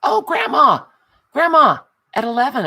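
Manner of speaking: high, loud, delighted cry of recognition; bright burst of delight at seeing Grandma's number; excited. Real, not acted. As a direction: call it out like you just spotted her. Excited on spotting a low number.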